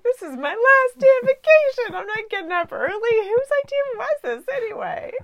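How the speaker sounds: high, loud whiny complaint; grumpy whining. Retold or imitated. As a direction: whine it like a sulky kid. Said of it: "I was like".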